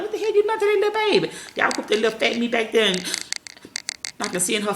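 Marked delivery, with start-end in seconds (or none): 0.0-1.3 high, fast, outraged church lady; shocked churchgoer. Retold or imitated. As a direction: squeal it like a scandalized onlooker. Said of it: "I didn't want folks like".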